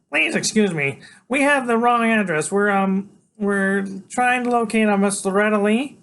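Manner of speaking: put-on high, loud boy's voice with hesitations; polite, nervous Freak. A narrator's reading. Freak explains the wrong address to strangers, high polite voice. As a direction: excuse yourself politely in a high little voice.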